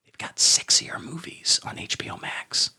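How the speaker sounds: slow, breathy seductive whisper; sexy whisper. Acted. Pitching the streaming service to viewers in a slow seductive whisper. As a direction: lean in and purr it, slow.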